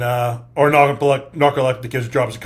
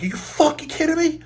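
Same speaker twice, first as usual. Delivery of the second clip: much higher, squeaky disbelief; incredulous outburst. Retold or imitated. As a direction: act stunned at what you are seeing. Partner asleep on duty again.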